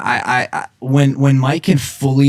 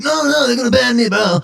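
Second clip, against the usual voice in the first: high-pitched imitation of a worried friend; put-on high, fretting voice; mock panicked objection; 12 semitones higher than usual. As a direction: squeal the protest in mock alarm.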